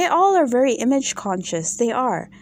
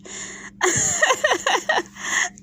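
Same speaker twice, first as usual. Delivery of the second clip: high squealing laugh. Real, not acted. Laughs at own pretend line.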